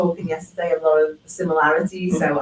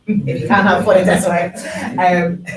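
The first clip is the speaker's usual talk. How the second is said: laughing; 7 dB louder than usual. Real, not acted. Laughs at own joke.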